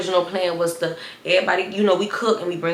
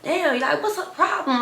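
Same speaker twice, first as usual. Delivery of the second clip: high incredulous; 9 semitones higher than usual. Retold or imitated. Reacting to classmate's attitude.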